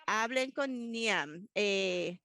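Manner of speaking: normal talking voice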